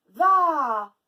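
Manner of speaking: VA very loud with a long a; stretched syllable. Shown as a demonstration. Teacher showing A returns, stretched syllable. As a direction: stretch Va into vaaa. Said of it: "on l'entend de nouveau".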